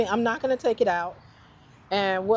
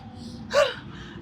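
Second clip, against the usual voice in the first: frightened shaky sigh; 4 dB quieter than usual. Real, not acted. Panics over a worm.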